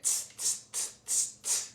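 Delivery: soft voiceless "tss" repeated in little bursts; monkey chatter. A narrator's reading. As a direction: imitate monkeys with quick hissy tuts. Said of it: "and said".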